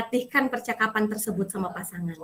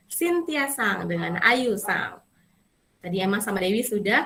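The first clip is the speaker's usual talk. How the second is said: ordinary delivery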